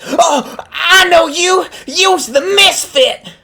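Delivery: very high, loud grandma shriek; blurting grandma. Acted. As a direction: shriek in alarmed recognition as a grandma. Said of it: "unable to keep her little yap shut".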